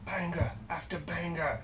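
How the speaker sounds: lightly put-on Irish accent, under the breath; mock Irish accent. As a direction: try a little leprechaun Irish accent, softly. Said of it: "that sounds British".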